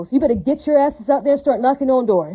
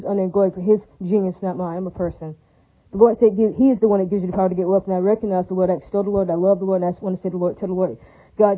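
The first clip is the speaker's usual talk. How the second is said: lower, earnest prayer of praise to God; 4 semitones lower than usual. Real, not acted. Speaker pauses the rant to praise God, in a lower devoted prayer.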